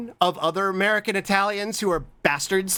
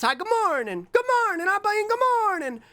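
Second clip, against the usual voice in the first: high greeting voice. Pretend.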